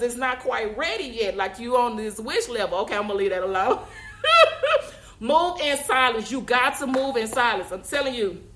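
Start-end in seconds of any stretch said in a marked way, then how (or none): 3.8-4.9 high-pitched laugh. Real, not acted. Speaker giving up on her Wish joke, laughing.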